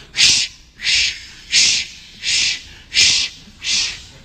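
soft rushing shh like surf; waves shushing. Shown as a demonstration. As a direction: hush it like waves on the shore. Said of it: "you're the waves over here go".

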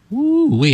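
falsetto woo-wee whoop; 1 high whoop. Real, not acted. Pastor whooping during deliverance, high falsetto whoop.

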